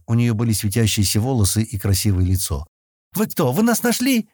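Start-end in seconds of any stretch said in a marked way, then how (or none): 3.1-4.3 high-pitched hopeful, happy cry; joyful. A narrator's reading. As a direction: pitch soars with delight. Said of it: "радостно воскликнула она".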